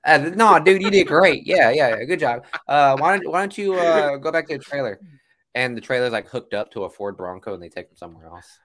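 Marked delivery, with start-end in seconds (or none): 0.1-5.0 loud, forced reassurance; over-reassure while sending him off; fake praise. Producer to replaced actor, loud fake praise.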